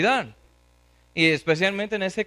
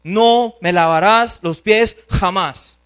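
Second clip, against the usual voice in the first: loud, slow, furious prohibition; very angry refusal; 8 dB louder, slower than usual. Acted. Preacher shows how Peter really answered Christ, very angry. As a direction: thunder each phrase slowly and forcefully. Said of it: "Era muy bravo".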